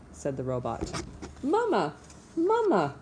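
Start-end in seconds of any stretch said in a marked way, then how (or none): 1.4-2.9 put-on high, squeaky baby-bird chirp; peeping gosling. A narrator's reading. Newborn gosling calling the robot mama, high peeping voice. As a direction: peep it out like a tiny hatchling. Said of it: "peeped the gosling".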